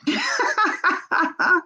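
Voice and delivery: a high real burst of laughter. Real, not acted. Laughing to her viewers at her own joke, high laugh.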